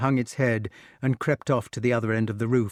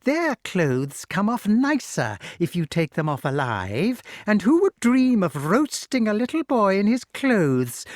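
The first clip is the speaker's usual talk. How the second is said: higher cackling hag voice; gloating witch; 7 semitones higher than usual. A narrator's reading. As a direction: relish the cruelty.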